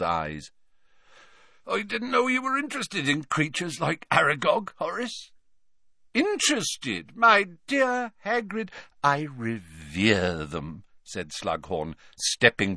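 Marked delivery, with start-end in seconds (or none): 1.7-5.3 a hint of tears, higher; fond grieving praise; tearful Hagrid. Hagrid praises his spider to Slughorn, lightly tearful. 6.1-10.8 high, theatrical sorrowful wizard; Slughorn theatrical. Acted. Slughorn laments to Hagrid, high and theatrical.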